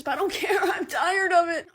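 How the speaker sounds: high exasperated shout. Real, not acted. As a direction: blurt out being fed up. Exasperated outburst.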